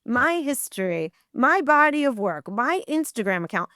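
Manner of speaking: retold emphatic dressing-down; indignant telling-off. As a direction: list your credits pointedly, rising to an outraged question. Tells off the producer.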